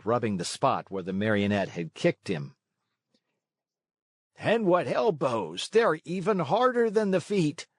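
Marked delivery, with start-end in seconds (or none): 4.4-7.7 put-on high, complaining shout of another boy; schoolboy shout. A narrator's reading. Boy hit in the stomach shouting at the puppet, high complaining shout. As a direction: yell it, still smarting from the blow. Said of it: "shouted another one".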